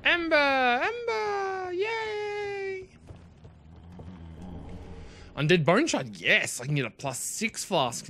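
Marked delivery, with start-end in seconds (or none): 0.0-2.9 very high squealing cry of joy; ecstatic cry. Real, not acted. Gamer finding a rare ember, a very high, ecstatic squeal. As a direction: squeal the name like you've won the lottery. Finds a prized ember item.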